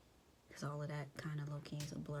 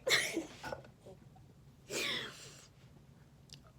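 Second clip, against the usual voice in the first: high breathy shriek of laughter; squealing laugh. Real, not acted. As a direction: let it break into a squealing laugh. Laughing at the memory.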